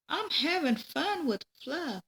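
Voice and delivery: put-on high, reluctant little-girl plea; whiny child. A narrator's reading. Peggy pleading with her mom to skip dinner, high and whiny. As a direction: whine like a kid who'd rather play. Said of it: "Peggy asked".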